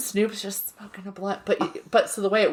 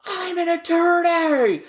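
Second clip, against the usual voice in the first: high, loud, slow hammed-up yell; mock yell; 10 semitones higher, 3 dB louder, slower than usual. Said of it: "another guy yelling".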